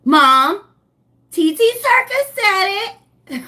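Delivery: put-on high, loud, drawn-out kid voice; high-pitched mock tattletale; imitating tattling child. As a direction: mimic a little one telling on auntie. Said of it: "cannot have my baby in here talking about".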